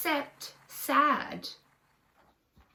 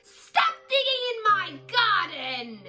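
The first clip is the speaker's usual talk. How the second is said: high, shrill-pitched scream; 9 semitones higher than usual. Acted. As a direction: scream at the dog in the garden. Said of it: "Missus Cripps screamed".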